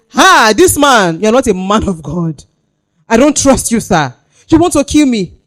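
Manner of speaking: loud, high defiant accusation; defiant Isaac. Acted. A preacher voicing Isaac confronting his father on the altar, loud and high. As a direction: shout him down as a traitor. Isaac voiced defying Abraham.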